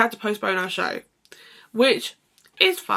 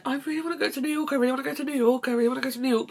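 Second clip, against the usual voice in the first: high, repeated eager plea, mock longing; retold childish wish, pitched higher, beginning fast; imitating eager pleading; 5 semitones higher, much faster than usual. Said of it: "And I always said".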